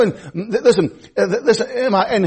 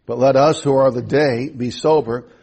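lower, measured reading of King James verses; scripture reading; 7 semitones lower than usual. Acted. Preacher reads First Thessalonians to his congregation, in a calm, measured reading voice. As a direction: read the passage steadily to the church.

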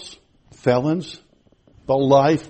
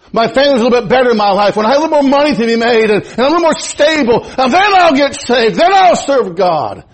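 excuse-maker, building up; 9 semitones higher, 6 dB louder than usual. Acted. Preacher voicing a procrastinator's excuse.

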